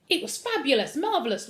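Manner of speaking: bright, bubbling delight; jubilant. A narrator's reading. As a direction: gush it out, overjoyed. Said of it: "Lloyd said jubilantly".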